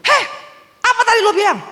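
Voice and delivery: high, loud "heh" of an angry driver; angry confrontation. Retold or imitated. Pickup driver confronting her over the insult, angry and loud. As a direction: bark it at her like a furious driver.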